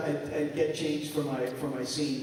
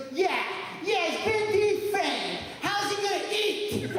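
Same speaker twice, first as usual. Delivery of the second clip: southern spider lady; 13 semitones higher than usual. Retold or imitated. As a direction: play the lady's amused southern lilt. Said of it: "she's from, like, the southern states".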